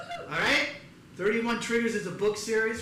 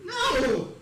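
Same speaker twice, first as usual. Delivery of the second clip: sharp high-pitched denial; hammed-up motherly "no"; indignant mother; 10 semitones higher, 4 dB louder than usual. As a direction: cry out as an offended mom. Mother denies it's cancer.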